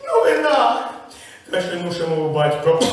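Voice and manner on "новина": excited exclamation. Acted. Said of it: "Мати сплеснула руками".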